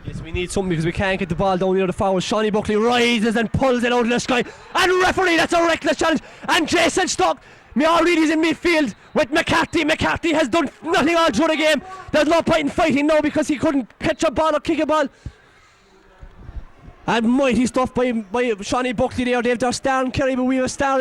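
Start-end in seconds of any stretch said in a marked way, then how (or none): 4.8-15.1 outraged appeal. Real, not acted. Commentator to referee about a reckless challenge, indignant and raised. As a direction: protest the foul, pitch up and push.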